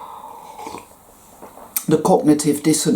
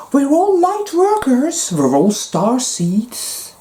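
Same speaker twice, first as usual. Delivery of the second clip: much higher, slow and dreamy, in a put-on voice; mock airy new ager; 11 semitones higher, slower than usual. Mocking the new agers who call themselves star seeds, slow and sing-song. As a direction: float the words like a starry-eyed believer.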